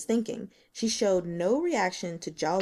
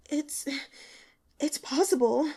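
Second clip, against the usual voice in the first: put-on higher, halting girl's voice; hesitant young woman; 6 semitones higher than usual. A narrator's reading. Li Qian evading the investigator, faltering. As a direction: stumble over the admission.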